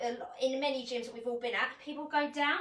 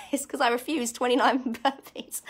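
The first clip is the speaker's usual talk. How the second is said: laughing; 7 dB louder than usual. Real, not acted. Joking refusal, laughing.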